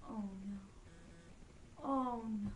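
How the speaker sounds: low-key, sinking dismay; dismayed realization. A narrator's reading. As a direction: let the joy drop away into worry. Pinchas stops in sudden worry.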